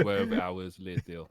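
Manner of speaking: put-on slow French announcer accent; slow French narrator, like a cartoon title card. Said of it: "gotta do the French voice, too".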